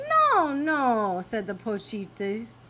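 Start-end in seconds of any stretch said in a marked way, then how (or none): 0.1-1.2 higher, louder chorus-like denial; lightly voiced small dogs saying no; little dogs correcting.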